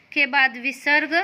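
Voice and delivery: ordinary talk, nothing marked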